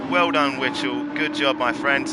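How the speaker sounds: loud congratulations. Real, not acted. Host cheering the second-place driver, loud and bright. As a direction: cheer the winner over the line.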